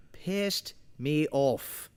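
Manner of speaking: slow, drawn out. Real, not acted. Angry complaint about menu.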